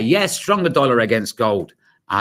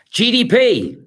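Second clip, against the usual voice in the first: excited announcement; 11 semitones higher, 6 dB louder, slower than usual. Real, not acted. Announcing the data release.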